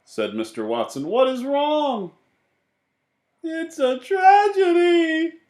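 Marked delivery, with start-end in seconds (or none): 1.1-2.1 high tender husband voice; high fussing husband. Acted. Husband asks crying wife. 3.4-5.4 high, loud, slow wailing lament; high slow mock-tragic wail; crying. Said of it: "Missus Watson was crying".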